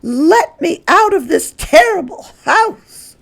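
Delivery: high, loud outraged shriek. Acted. Mrs Hipple shrieks to get out, loud and outraged. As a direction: demand to escape the awful house. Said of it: "Missus Hipple shrieked".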